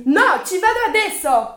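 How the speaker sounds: high, loud insisting; defiant yell. Acted. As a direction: refuse to back down. Daughter insists in quarrel.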